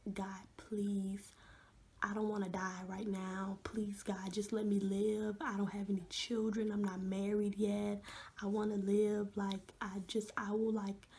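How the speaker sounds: ordinary talk, nothing marked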